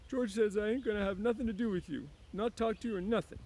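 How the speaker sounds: high Lenny, sullen; Lenny defensive. A narrator's reading. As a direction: parrot George's warning stubbornly. Lenny refuses her.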